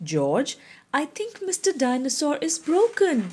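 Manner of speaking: put-on high little girl piggy voice, rising sharply; a girl pig breaking bad news to her brother; Peppa's voice. Peppa telling George his toy is broken, high and childlike.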